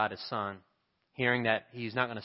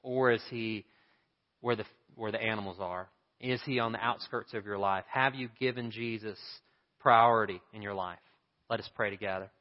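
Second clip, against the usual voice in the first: ordinary talk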